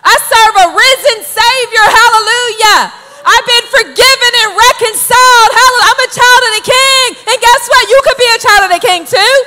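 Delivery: high, loud, exuberant testimony; fervent, full-voiced witnessing; uncontainable zeal. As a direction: burst out as a new believer who must tell everyone. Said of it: "you just couldn't contain it. The zeal was there".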